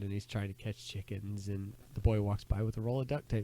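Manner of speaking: usual speaking voice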